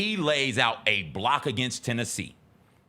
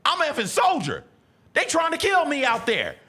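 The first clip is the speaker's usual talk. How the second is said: much higher and louder, wild yell; scream; 4 dB louder than usual. Retold or imitated. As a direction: scream it like a player in a frenzy. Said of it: "he just screams out".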